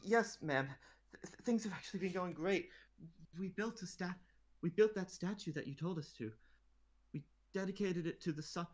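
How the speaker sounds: stammering fan meeting his idol; nervous boy. Acted. Boy applicant blurts out to the queen, lightly voiced and excited. Boy reports about statue.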